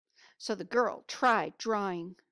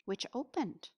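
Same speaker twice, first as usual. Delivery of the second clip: plain reading voice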